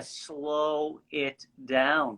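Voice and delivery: deliberately slow, drawn-out words. Shown as a demonstration. Told they rush, demonstratively slowing right down. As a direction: stretch each word right out.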